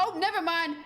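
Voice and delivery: mock yell from the front row; imitated yelling. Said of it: "he's, like, yelling that".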